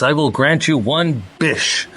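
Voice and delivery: "bish" said with a B for "wish"; slow, grand genie line saying "bish" instead of "wish"; a touch of a pompous genie saying "bish"; genie mispronouncing. Retold or imitated. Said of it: "you mean wish?".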